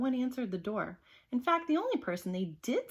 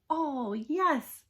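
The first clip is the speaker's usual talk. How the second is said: higher, louder delighted cry; eager cry; 7 semitones higher, 5 dB louder than usual. Acted. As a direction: cheer it out. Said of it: "cried the kids".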